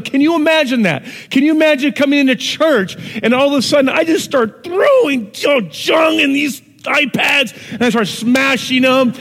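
loud and high, ramping up the havoc; preaching at full force. Acted. Preacher roaring to the congregation about Jesus overturning tables. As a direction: shout out the table-flipping scene.

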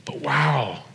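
whispered amazement; hushed awe. Real, not acted. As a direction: let it out on a breath, in wonder. Awed by his saved sins.

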